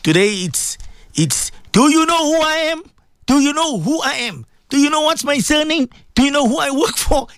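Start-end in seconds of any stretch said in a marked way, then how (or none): 1.7-7.3 high-pitched indignant challenge; upset boasting threat. Acted. Preacher acting out Muslims quarrelling, indignant and high-pitched. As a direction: pull rank on them, voice pitched up. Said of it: "when they get upset".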